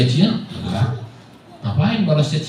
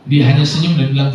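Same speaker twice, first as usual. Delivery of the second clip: ordinary talk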